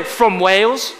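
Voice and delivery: lightly put-on British accent, questioning rise; a hint of British in the vowels. Shown as a demonstration. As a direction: demonstrate a mild British accent for the crowd. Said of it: "in your best British accent".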